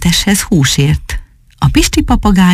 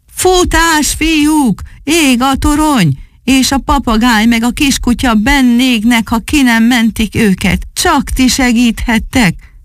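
high-pitched, urgent rallying call; 7 semitones higher than usual. A narrator's reading. Policeman calls the robbers to a burning tower, urgent cry. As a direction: call them to action, pitched high throughout. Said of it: "és bekiáltott nekik".

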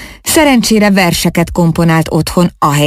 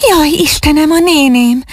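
high, alarmed outcry; frightened cry; 11 semitones higher than usual. Acted. Ágnes remembers her aunt, high frightened cry. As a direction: gasp it out in sudden fright. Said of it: "kiáltott fel ijedten".

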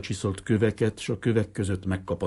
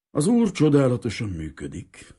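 mechanical platitude; 5 semitones higher, 6 dB louder than usual. Acted. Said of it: "mondta gépiesen a szája".

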